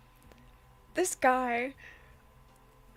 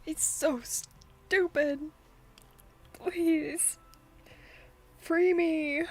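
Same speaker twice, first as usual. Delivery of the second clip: exasperated drawl; 4 semitones higher, 3 dB louder, much slower than usual. Real, not acted. Exasperated at dense game character.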